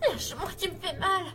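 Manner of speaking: high, crying plea in pain; pained pleading. Acted. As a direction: beg to be let go, hurting. Victim grabbed, in pain.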